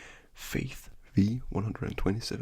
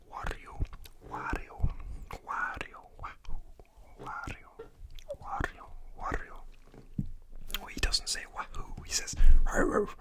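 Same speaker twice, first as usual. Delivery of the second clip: ASMR whisper. Acted. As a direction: whisper good night gently, like ASMR.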